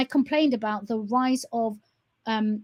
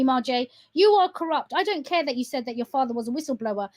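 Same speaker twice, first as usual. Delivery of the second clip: normal talking voice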